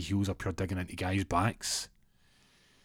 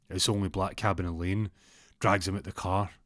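ordinary delivery

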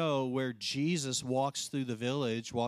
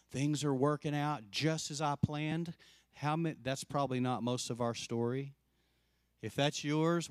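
usual speaking voice

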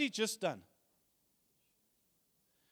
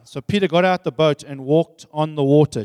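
ordinary talk, nothing marked

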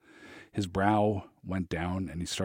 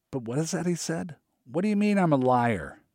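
protesting; 9 semitones higher than usual. A narrator's reading. Richie protests, pitch high.

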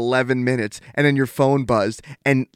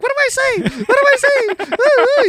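very high squeaky mock panic, much louder; high-pitched teasing impression; mock squeal; 5 dB louder, faster than usual. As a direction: squeal it like a giddy teen who doesn't know what to text. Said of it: "and you're like".

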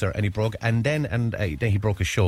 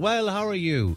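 a light, higher chirpy Irish hello; Irish greeting; 10 semitones higher, slower than usual. Retold or imitated. Host mimicking the typical Irish greeting, lightly higher. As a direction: toss off a breezy greeting. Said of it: "the old kind of the Irish thing".

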